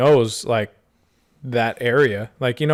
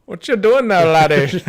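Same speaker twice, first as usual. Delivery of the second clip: high, loud hammed-up Scottish old man; a silly Scottish old-timer voice; Scottish accent; 8 semitones higher, 9 dB louder than usual. Old miner asks the victim, hammed-up Scottish accent. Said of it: "don't know why I went Scottish".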